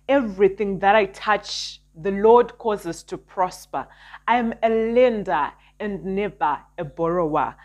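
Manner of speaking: fervent prayer declarations; self-help influencer. Acted. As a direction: declare each blessing with conviction. Declares prosperity affirmations.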